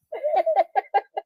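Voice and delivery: high burst of laughter; high, breaking laugh. Real, not acted. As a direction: crack up after singing. Bursts out laughing after singing.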